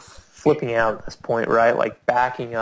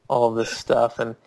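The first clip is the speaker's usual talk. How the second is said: ordinary talk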